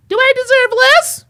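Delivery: squeaky parody of the caller; mock high voice. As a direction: parody her question in falsetto. Mocking the caller's voice.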